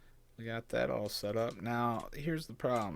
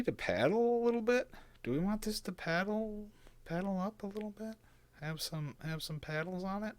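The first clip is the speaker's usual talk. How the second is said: high playful voice; 13 semitones higher, 3 dB louder than usual. Real, not acted. Playful questions to viewers.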